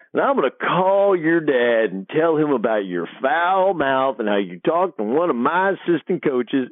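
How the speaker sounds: slow, drawn-out imitation of a scolding coach; Coach Sutton. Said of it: "coach is hot".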